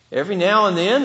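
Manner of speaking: ordinary talk, nothing marked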